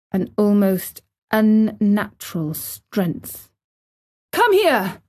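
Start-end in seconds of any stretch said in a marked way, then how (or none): 4.5-5.0 high, urgent summons; shouted. A narrator's reading. Boy summons Dickon in anger, shouting. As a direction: call out sharply, pitched up. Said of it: "he shouted to Dickon".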